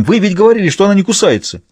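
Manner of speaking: high-pitched, outraged protest; indignant exclamation. A narrator's reading. Bitten city dandy accusing the farmer, indignant. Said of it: "с возмущённым восклицанием".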